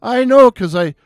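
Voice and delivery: put-on high, excited Irish accent, ranting; an Irish barman venting about his day on the phone; Irish bartender impression. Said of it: "beautiful restful Irish voice".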